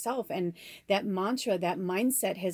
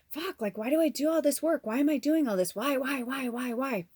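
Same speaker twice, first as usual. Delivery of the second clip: higher-pitched exasperated venting; frustrated; 5 semitones higher than usual. Retold or imitated. Asking herself why she does all this work, frustrated. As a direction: plead why over and over, exasperated. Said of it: "I'm frustrated, right? I'm just like".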